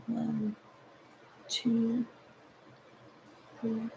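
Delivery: hushed stitch count; counting under breath. Real, not acted. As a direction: count quietly to yourself.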